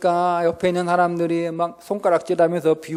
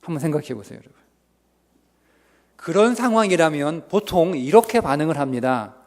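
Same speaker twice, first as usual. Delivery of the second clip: usual speaking voice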